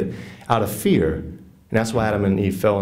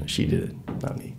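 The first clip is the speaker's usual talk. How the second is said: whispered, dropping softer at the end; sheepish whisper; 8 dB quieter than usual. Acted. Adam whispers the blame onto Eve before God. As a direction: hush it out like a guilty child dodging blame.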